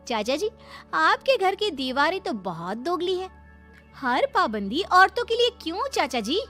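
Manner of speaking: high, swooping sarcastic retort; indignant bride, shrilly high questioning; defiant young wife. Acted. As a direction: challenge the elder, pitch leaping up.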